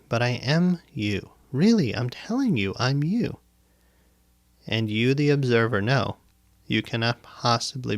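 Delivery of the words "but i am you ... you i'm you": higher, swooping mock insistence; insistent claim. Shown as a demonstration. Guide pretending to be the listener's self, mock insistent. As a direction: press the false claim as if to convince. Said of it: "I can sit here now behind this microphone and say".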